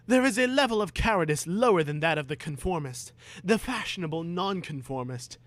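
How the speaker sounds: put-on lofty preachy boy. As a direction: lecture like a smug know-all.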